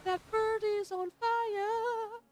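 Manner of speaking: high, very slow, stretched exclamation; drawn-out excited exclaim. Real, not acted. Caster hyping a burning hero, drawn-out high exclaim. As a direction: sing out the moment like a hype call.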